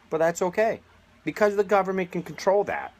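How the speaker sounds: exaggerated sarcastic voice mocking the government line; mock-cheerful sarcasm. Real, not acted. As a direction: sneer it like a fake reassurance. Sarcasm, repeated and pushed higher.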